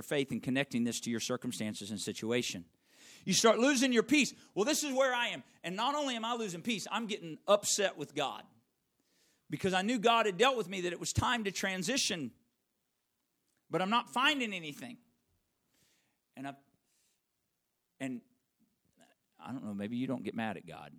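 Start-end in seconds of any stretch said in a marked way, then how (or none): 3.3-15.0 loud, high, driving sermon voice; full-force preaching. Real, not acted. Pastor rousing the congregation at full volume. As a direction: preach it hard to the room.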